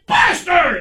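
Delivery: angry dad yells the dog's name; yelling at the dog. Retold or imitated. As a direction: bark the dog's name across the dinner table. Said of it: "he's yelling at the dog".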